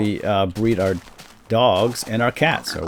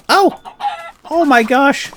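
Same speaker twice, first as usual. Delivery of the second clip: shocked yelp; 8 dB louder than usual. Real, not acted. Accidentally hits the pig.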